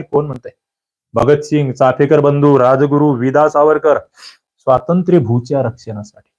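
normal talking voice

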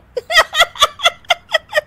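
long high-pitched fit of giggles; high giggling fit. Acted. Bride laughing after the spill, high giggling fit. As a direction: burst into helpless giggles. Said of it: "Anshu फिर से हंसने लगती है".

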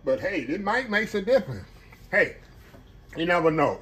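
ordinary delivery

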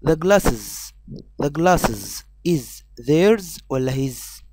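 "theirs" and "his" drawn out slowly; teacher slowly reads the glasses question; very slow quiz read. Shown as a demonstration. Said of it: "سؤال تاني".

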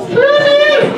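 very high squeaky shout for a lost cat; highest pitched call. Shown as a demonstration. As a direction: call out as high as you can. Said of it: "call out in the highest pitched voice you can imagine".

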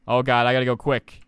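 usual speaking voice

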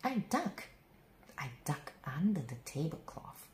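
ordinary delivery, nothing marked

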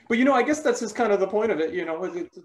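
building to tears. Acted. Actress substitutes her dead cat for a brother, breaking into tears. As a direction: start rambling, climb into hammed-up crying. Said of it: "I'm supposed to cry about my brother".

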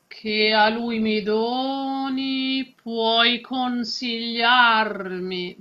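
slow, vowels in "che a lui mi doni" stretched long. Shown as a demonstration. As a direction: stretch the vowels as in spoken Italian. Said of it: "some vowels which are longer than ours".

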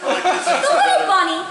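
ordinary delivery

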